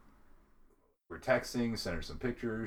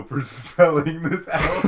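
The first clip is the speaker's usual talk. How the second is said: laughing sarcastic thanks; 5 semitones higher, 12 dB louder, slower than usual. Real, not acted. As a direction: giggle through the thanks. Teasing friend over spelling.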